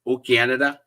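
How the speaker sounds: jokey attempt at a Canadian accent. Shown as a demonstration. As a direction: put on a hammy Canadian "oh, Canada". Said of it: "that was my Canadian accent. Um, terrible, probably".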